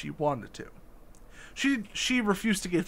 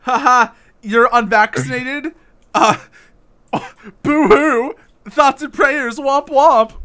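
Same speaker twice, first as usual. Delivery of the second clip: high loud mocking glee; mock gloating; 7 semitones higher, 9 dB louder, slower than usual. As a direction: gloat in a jeering sing-song. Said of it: "mocking the tweeter's glee".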